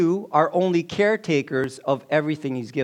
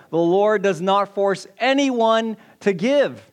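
raised, slow stress on "does not force anyone"; emphatic repeat; 5 semitones higher, 4 dB louder than usual. Real, not acted. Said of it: "Let me repeat that again".

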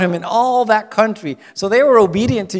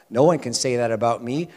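usual speaking voice

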